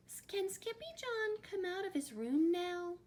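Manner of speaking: very high little-kitten voice; little kitten sister. A narrator's reading. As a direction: ask mama in a tiny high voice. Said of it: "asked Jujubee".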